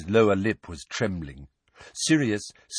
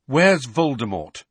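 raised, louder urgent asking; urgent question; 6 semitones higher, 6 dB louder than usual. A narrator's reading. Boy asking what has obsessed him, urgent. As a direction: ask it pointedly, at last. Harry asks his burning question.

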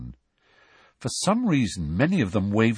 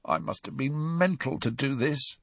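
hoarse, hushed and higher; croaky whisper; 5 semitones higher, 6 dB quieter than usual. A narrator's reading. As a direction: croak it out under the breath. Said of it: "he said in a croaky whisper".

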